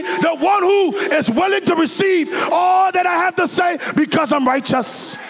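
high, ringing preacher's cry, building to a call; soaring sermon climax with singing tones; full-force preaching. Acted. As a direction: preach it out to the whole church, voice lifted high.

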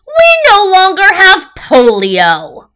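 high, loud, drawn-out imitated scream; Hannah Gatsby. Comedian screaming it at the audience, imitated exasperated shout. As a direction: shout it slowly at the whole audience. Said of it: "she screams it at the audience".

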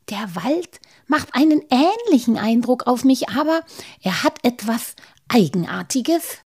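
a shy gnome owning up, voiced high; Halbschuh the gnome.

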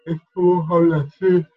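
sleepy slow-motion speech. Shown as a demonstration. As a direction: drag the words as if half asleep. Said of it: "lento y con sueño".

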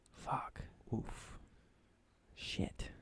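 breathy whispered curses under the breath; panicked whisper to himself. Retold or imitated. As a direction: mutter the swears in a whisper. Panicked aside while reading request.

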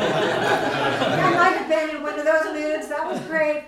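loud, slow, laughing admission. Real, not acted. Laughing to the group about her own moods, loud.

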